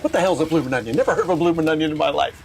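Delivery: high-pitched, incredulous stand-up bit; imitating a comedian. Retelling a comedian's bit about the bloomin' onion, mock incredulous. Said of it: "I just listened to an Australian comedian".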